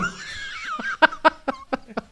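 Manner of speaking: high-pitched burst of laughter; laughing. Real, not acted. Co-host ends his burning incense anecdote, bursting into laughter. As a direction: crack up at your own story.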